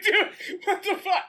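high, laughing disbelief; laughing outrage. Retold or imitated. Player laughing in disbelief at the news report, high and squealing. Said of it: "I was like".

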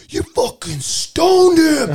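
high, loud, slow stunned exclamation; hunting partner bursts out after a clean kill, high and loud; exhilarated. Retold or imitated. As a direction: blurt it out, overwhelmed with excitement. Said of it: "so, like, emotional and, like, exhilarated".

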